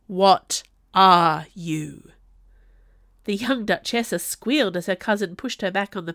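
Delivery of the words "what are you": loud, drawn-out furious question; enraged bellow. A narrator's reading. Mad cousin demanding answers of the Duchessa, bellowing slowly. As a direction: stretch every word out in rage. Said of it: "he bellowed in pure rage".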